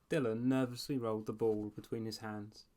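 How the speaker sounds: usual speaking voice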